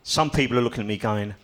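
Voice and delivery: normal talking voice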